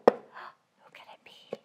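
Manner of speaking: breathy slow whisper; slow suspenseful whisper. Acted. Suspense before opening box.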